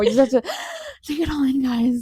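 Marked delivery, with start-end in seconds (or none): 0.4-1.0 mock gasp. Real, not acted. Taking it all in.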